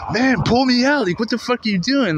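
high, desperate mock yell; retold scream. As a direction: reenact pleading frantically with a bystander. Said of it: "I'm screaming at this old man".

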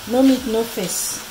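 ordinary talk, nothing marked

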